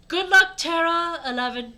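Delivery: high, loud send-off cheer; crying out. Acted. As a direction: call it out as a crowd would. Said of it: "everyone cried".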